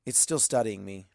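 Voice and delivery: usual speaking voice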